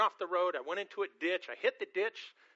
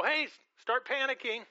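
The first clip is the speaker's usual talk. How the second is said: higher, louder panicked call to the passengers; mock yell; 7 semitones higher, 4 dB louder than usual. Preacher yelling at passengers in a sliding car, mock panic shout. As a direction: yell it out like sounding an alarm. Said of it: "I start yelling to the people".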